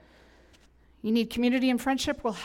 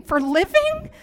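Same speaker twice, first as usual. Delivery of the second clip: higher and louder, disbelieving question; incredulous echo; 10 semitones higher, 7 dB louder, slower than usual. Retold or imitated. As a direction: throw the word back in disbelief.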